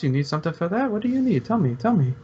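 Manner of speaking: street dealer hustle. Acted. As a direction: keep nagging them to buy.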